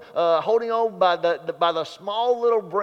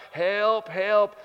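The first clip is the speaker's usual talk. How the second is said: calling out for help; 3 semitones higher than usual. Retold or imitated. Stranded man calls for rescue, mock calling out. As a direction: call up the cliff, half-acted. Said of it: "he is calling out".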